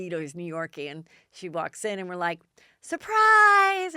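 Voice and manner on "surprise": loud, high group shout; shouted surprise. Retold or imitated. Guests greeting the birthday friend, a loud high shout. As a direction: yell it as she walks in.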